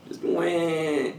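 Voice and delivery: high mock wail of a demon child; deep child crying. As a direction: wail like a deep-voiced kid. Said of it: "sounds like the crying of a child, but, like, a little deeper".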